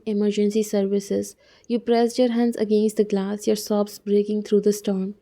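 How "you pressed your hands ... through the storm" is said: ordinary delivery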